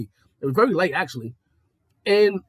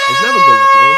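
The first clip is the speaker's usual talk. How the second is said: much louder, hitting never; loud emphatic repeat; 5 semitones lower, 13 dB louder than usual. Shown as a demonstration. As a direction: blast it out for emphasis.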